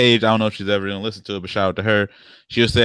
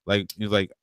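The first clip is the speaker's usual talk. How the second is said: ordinary delivery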